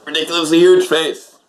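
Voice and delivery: high, loud announcer-style echo; mock-grand echo. Host joining co-host's close-up bit, mock-grand.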